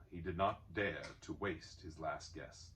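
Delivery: ordinary delivery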